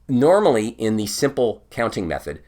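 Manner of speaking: normal talking voice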